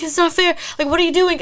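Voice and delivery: high, loud retold protest; breathless panic. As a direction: reenact a panicked objection. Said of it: "I could not breathe".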